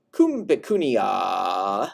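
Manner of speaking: slow, low circumstantial tone. Shown as a demonstration. Teacher demonstrating low ablative tone. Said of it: "with this low tone of voice".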